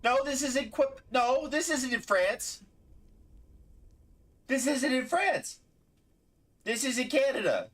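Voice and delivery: high-pitched outraged protest; outraged disbelief. Real, not acted. Outraged correction.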